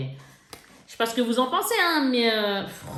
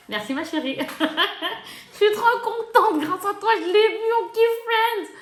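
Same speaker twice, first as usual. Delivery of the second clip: higher, laughing gratitude; laughing thanks; 12 semitones higher, 3 dB louder than usual. Real, not acted. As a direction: giggle your thanks to a friend. Remercie son amie Karine. (remercie Karine en riant, voix plus aiguë)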